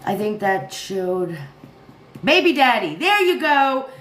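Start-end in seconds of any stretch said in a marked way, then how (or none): none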